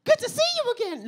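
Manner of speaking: high, loud, overfriendly stranger voice; a way-too-cheerful stranger; chirpy stranger greeting. Retold or imitated.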